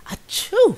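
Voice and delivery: big sudden sneeze; a loud high achoo. Acted. Mouse sneezes in story.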